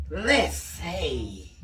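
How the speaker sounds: low, slow little bug voice. Pretend. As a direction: creep it out like a bug. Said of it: "Let me get in my bug voice".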